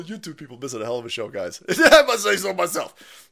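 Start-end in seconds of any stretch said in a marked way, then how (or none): 1.7-3.3 a light self-satisfied anchorman voice, slowed; hint of a smug newsman; Ron Burgundy. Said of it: "My best Ron Burgundy impression".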